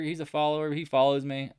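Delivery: normal talking voice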